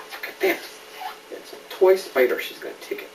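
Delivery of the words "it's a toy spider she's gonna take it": usual speaking voice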